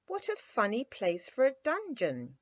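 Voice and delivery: high, amused exclamation; a girl's surprised cry at the odd place; exclaiming. A narrator's reading. Little girl exclaims to the toy captain, surprised. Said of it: "exclaimed Dimples".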